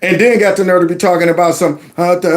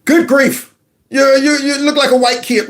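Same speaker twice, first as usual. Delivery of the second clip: exasperated; 8 semitones higher than usual. Real, not acted. Exasperated ridicule.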